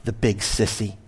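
shaky voice. A narrator's reading. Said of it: "broken by a shaky voice saying".

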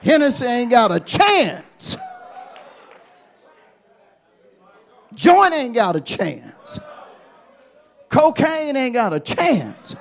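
loud, slow, shouted declaration; preaching shout. Acted. Preacher shouting to the church about sin's defeat. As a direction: hammer each line home.

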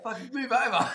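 high, half-laughing joke protest; laughing mock complaint. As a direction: blurt it out while cracking up. Jokingly tells naked guy move.

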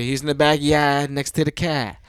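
Boston "backyard" and "car", broad vowels, no R's; Boston accent. Retold or imitated. Jaws husband corrected by wife, Boston accent on "backyard" and "car". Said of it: "No, that's not how you say it".

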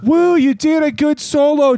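high, loud mock praise; whoop, then call out to the drummer; fan heckling cheer. Acting a fan praising [name], mock cheering.

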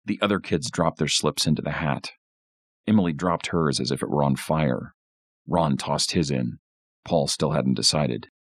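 ordinary delivery, nothing marked

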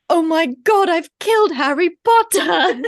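panicked cry, high and loud; mock horror, wailed out on the edge of laughter; Neville. Neville thinking Harry has drowned in the lake, panicked. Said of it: "Neville turns around and goes".

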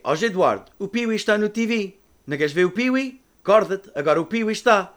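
put-on loud, high mom calling kids awake; mother calling out. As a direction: call the kids like an excited mom. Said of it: "my mother in Portuguese would say".

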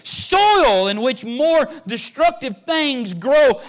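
loud, high preaching build that slows at the end; full-force preaching. Acted. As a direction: preach it out to the whole church.

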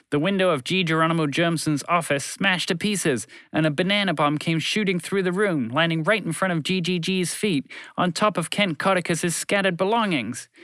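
usual speaking voice

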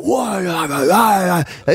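garbled mock stadium chant; imitated crowd roar. Walking into a football stadium, imitated crowd shouting. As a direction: holler like thousands in unison. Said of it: "you walk in and you hear it".

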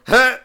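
sudden "huh!" burst; imitated theater reaction. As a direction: re-enact a startled outburst at a character popping up. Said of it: "I just went".

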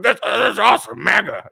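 high, loud bro cheer; mock fan cheer. Conservative men cheering Trump's lie, high loud mock cheer. Mocking fans defending Trump.

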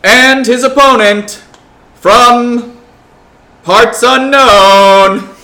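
loud, slow boxing announcer call; ring announcer. Acted. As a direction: stretch every word for the crowd. Announcing fighters in the arena.

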